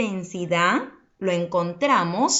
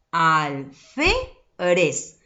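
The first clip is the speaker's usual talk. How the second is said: "alférez" slowly split as al, fé, rez; drawn-out "alférez" in three separate syllables; 3 semitones lower, much slower than usual. Shown as a demonstration. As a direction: sound out "alférez" with pauses, leaning on the middle fé. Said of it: "¿Dónde está la mayor fuerza de voz?".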